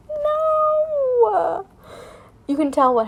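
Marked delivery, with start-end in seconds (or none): 0.1-1.6 long, very high drawn-out wail; hammed-up cry of dismay; mock wail. Dramatic reaction to the story.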